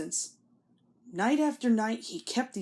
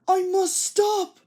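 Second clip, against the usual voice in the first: high, loud, slow strained plea; desperate; 10 semitones higher, 6 dB louder, slower than usual. A narrator's reading. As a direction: strain every word to stop. Thomas eyes shut, desperate.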